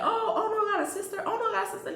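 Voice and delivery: high, quick incredulous question; imitated surprise. Acting out others' surprised reaction.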